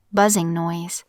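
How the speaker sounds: plain reading voice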